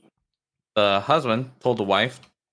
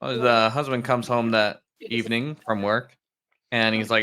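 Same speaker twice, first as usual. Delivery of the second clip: ordinary delivery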